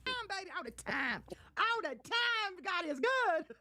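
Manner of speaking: high, drawn-out sing-song; sing-song gloat. Real, not acted. Streamer praising God over her rivals, high drawn-out sing-song. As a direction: stretch it out high like a hymn.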